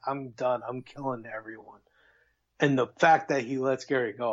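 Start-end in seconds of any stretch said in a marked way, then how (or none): 0.1-1.8 quiet killer resolve; cold and menacing. Retold or imitated. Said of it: "he fucking was just like".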